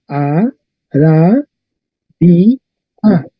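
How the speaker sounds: four separate syllables with gaps between; each syllable of "A, ra, di, a" said alone. Shown as a demonstration. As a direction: count out "A, ra, di, a" one syllable at a time. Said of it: "¿Cuántas sílabas?".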